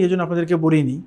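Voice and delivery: usual speaking voice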